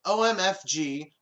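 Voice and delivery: ordinary talk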